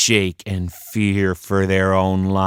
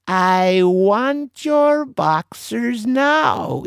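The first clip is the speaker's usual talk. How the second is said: high, slow menacing demand; pirate demand; 11 semitones higher, much slower than usual. A narrator's reading. Stinky Annie demanding Joe's boxers, high and slow. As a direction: draw out the demand.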